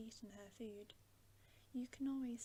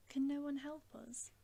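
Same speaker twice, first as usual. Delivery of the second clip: louder, higher plea, a mild wail; 4 semitones higher, 10 dB louder than usual. A narrator's reading. Lucy pleading with the Beavers for help, mildly wailing. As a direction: cry out for help with restrained despair. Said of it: "wailed Lucy".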